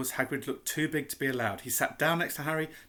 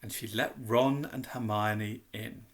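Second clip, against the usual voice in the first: ordinary delivery, nothing marked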